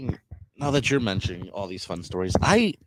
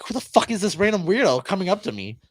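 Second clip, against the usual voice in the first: incredulous look voiced; 9 semitones higher, 4 dB louder than usual. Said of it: "Bravo's just looking at me".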